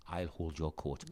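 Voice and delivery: low, very soft imitated offer; hushed, humble retold voice; quiet offer. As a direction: voice his modest offer under your breath. Retelling humble friend's polite offer.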